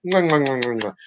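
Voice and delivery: kiss noises. Real, not acted. Kissing the dog goodbye.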